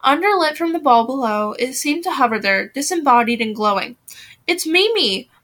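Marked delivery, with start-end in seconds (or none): 4.5-5.3 higher-pitched, mild cry of surprise; excited shout. A narrator's reading. Blythe spotting Mimi in the dark, mild excited shout. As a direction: call it out in a narrated shout. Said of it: "Blythe shouted and then screamed".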